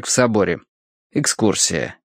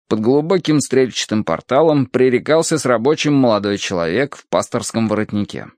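plain reading voice

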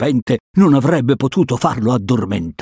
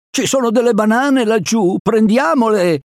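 high-pitched eager cry; excited, high-pitched urging; excited exclamation; 10 semitones higher than usual. A narrator's reading. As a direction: exclaim over a tempting find. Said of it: "esclamò".

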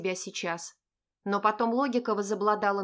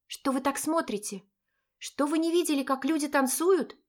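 indignant; 6 semitones higher than usual. Acted. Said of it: "возмутилась я".

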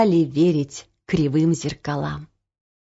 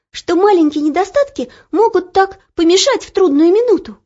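put-on high, fast grateful girl voice; excited young girl; 10 semitones higher, 4 dB louder, faster than usual. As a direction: gush thanks like an excited girl. Olya thanks the mirror.

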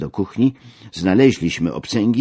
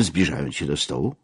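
ordinary delivery, nothing marked

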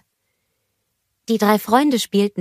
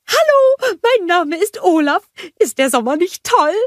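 much higher, louder bubbly snowman voice; cheerful snowman Olaf; 13 semitones higher, 5 dB louder than usual. A narrator's reading. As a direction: bounce up to the kids full of joy. Olaf greets children cheerfully.

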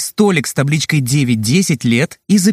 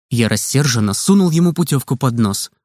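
plain reading voice

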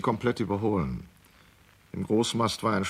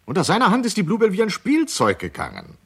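higher, louder, proud exclamation; proudly raised praise of the captain; excited; 10 semitones higher, 7 dB louder than usual. Acted. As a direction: announce it with rising enthusiasm. Line spoken twice, first raised.

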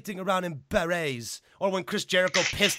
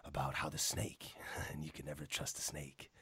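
Jake the Snake voice; 11 semitones lower, 15 dB quieter than usual. Retold or imitated. Showing a friend how Jake the Snake spoke, low and hushed. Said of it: "he talk like this".

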